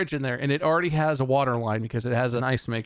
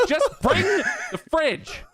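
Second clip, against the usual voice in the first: high, slow, laughing exasperation; mock-exasperated retort; exasperated plea; much slower than usual. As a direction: beg it out half laughing.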